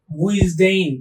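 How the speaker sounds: উইজডেইন said as উইজ-ডেইন, stressed and drawn out; coined word. Shown as a demonstration. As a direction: give উইজডেইন a slow, deliberate sound. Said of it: "মনে রাখতে পারেন কি দিয়ে". (উইজডেইন আলাদা করে উচ্চারণ)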